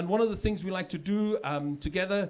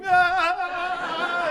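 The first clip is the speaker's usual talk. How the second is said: loud, high mock wail; wailing kid; 11 semitones higher, 6 dB louder than usual. Imitating a kid flailing on his back, loud high wail. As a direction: wail like a tortoise stuck on its back. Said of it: "trapped tortoise on his back just going".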